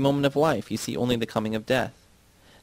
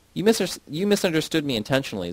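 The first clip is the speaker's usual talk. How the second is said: mildly angry objection, raised in pitch; 6 semitones higher than usual. A narrator's reading. Zednik accuses Myrna of misunderstanding him intentionally, mildly angry. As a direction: protest with a touch of anger. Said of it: "Zednik says angrily".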